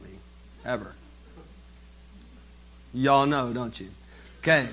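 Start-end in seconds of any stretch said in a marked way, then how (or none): none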